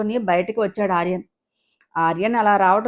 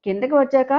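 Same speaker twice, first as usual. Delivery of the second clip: ordinary delivery, nothing marked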